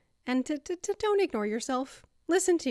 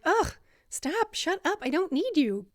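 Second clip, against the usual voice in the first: higher, fed-up groan into words; mock exasperation, pushing the voice away; exasperated brush-off; 6 semitones higher than usual. Fed up with that self-critical voice, exasperated. Acted rejection of the screaming voice.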